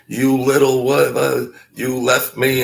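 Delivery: retold hollering. Man left behind comes out at his ride, mock hollering. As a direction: imitate an angry man hollering. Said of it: "and he's hollering".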